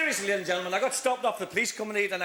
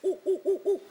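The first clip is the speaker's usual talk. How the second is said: high hooting ape calls; monkey noises; 9 semitones higher than usual. Acted. Comic playing the monkey, high hoots. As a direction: hoot like a chimp. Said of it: "And the monkey went".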